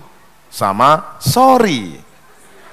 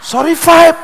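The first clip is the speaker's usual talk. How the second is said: high squeaky; 11 semitones higher, 4 dB louder, slower than usual. Acted. Worker answers boss in joke.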